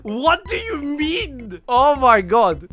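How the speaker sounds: high, loud, drawn-out disbelief; mock outrage. Streamer reacting to being called disrespectful, mock outrage. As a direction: stretch the words in incredulous indignation. Indignant reaction to criticism read aloud.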